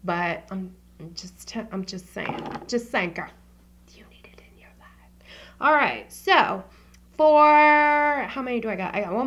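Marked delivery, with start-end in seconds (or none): 3.9-5.3 barely audible urging; hushed nudge to buy; conspiratorial whisper. Real, not acted. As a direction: whisper it like a secret tip. Whispered recommendation.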